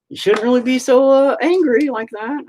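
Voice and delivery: high, mildly scolding lady voice; mimicking the lady. Retelling a customer's mild scolding, high and prim. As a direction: gently imitate a customer's tut-tut. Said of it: "So she was like".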